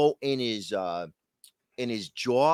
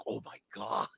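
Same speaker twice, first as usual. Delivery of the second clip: much softer, lower aside; hushed awe; 4 semitones lower, 11 dB quieter than usual. Retold or imitated. Reacting to Khabib's taunt, hushed awe. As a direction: drop to a stunned near-murmur. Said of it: "I was like".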